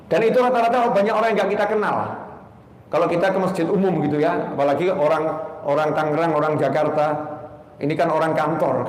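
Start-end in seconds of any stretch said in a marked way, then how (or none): none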